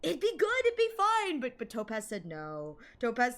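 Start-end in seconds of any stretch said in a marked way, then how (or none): 0.0-1.4 high-pitched insistent protest; mock pleading. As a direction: plead your case in a high voice. Playful pleading for own idea.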